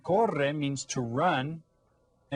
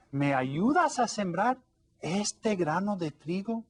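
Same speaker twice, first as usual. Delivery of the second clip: higher, lightly voiced hen asking a favour; hopeful storybook hen; little hen asking; 6 semitones higher than usual. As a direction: ask sweetly as the little hen. Hen asks duck in story.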